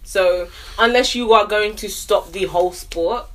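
ordinary talk

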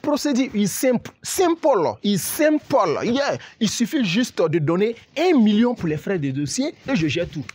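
high, excited broken French; fake American accent in French. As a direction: pitch the price like a con man. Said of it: "Je suis américain".

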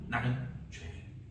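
Peter's voice, high, breaking into a hushed plea; pleading prayer. Acted. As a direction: cry out to the Lord, then fall to a whisper. (베드로의 간절한 기도)

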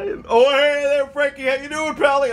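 high, loud buddy voice; his voice. Acted. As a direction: greet Frankie in a goofy voice. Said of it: "forgot to go into his voice".

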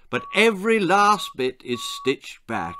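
false jollity. Acted. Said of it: "he said with false jollity".

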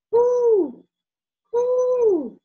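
high, loud hooting owl. Acted. Child playing owl for mom, high loud hoots. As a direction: hoot like a little owl asking. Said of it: "you ask".

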